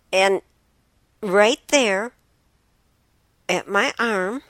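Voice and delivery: mounting panic in the retelling; rising alarm. Retold or imitated. Sudden snake discovery, rising alarm.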